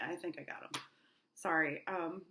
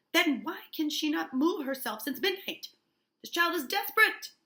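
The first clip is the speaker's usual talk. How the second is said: loud, high, desperate demand; heated accusation; 9 semitones higher, 7 dB louder than usual. Acted. Uncle demanding why the child cannot move, loud and heated. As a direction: act out an uncle at his wits' end. Paris accuses his niece.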